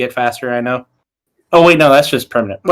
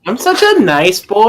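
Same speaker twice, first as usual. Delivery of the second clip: high-pitched teasing mimicry; mock the friend's innocent claim in a higher voice; mocking echo; 6 semitones higher than usual.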